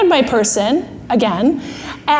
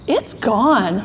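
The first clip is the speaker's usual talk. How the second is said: low, drawn-out final verdict; calm voice of reason; thinking brain; 4 semitones lower, 4 dB quieter than usual. Acted. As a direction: state "gone" slowly and firmly. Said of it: "Thinking brain finally says".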